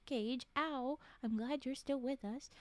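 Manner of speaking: ordinary talk, nothing marked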